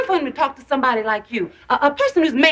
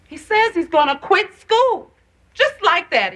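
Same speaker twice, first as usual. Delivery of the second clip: higher-pitched, distressed complaint; upset mother; 7 semitones higher than usual. Acted. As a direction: let the upset push your pitch up. Mother upset about son quitting.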